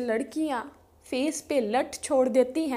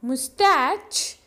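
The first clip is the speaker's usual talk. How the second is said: Moustache spoken slowly and clearly; slow model word; much slower than usual. Shown as a demonstration. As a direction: model moustache at half speed for learners. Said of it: "English में moustache बोलते हैं". (Moustache को धीरे-धीरे साफ़ बोलो)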